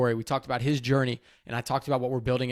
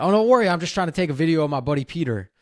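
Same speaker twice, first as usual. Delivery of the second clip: eager reply; 5 semitones higher, 5 dB louder, faster than usual. Retold or imitated. Host retells own reply.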